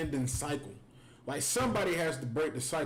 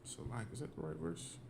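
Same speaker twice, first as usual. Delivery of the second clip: whispering to himself, unvoiced; under his breath, checking the verse; whispered aside; 5 semitones lower, 13 dB quieter than usual. Real, not acted.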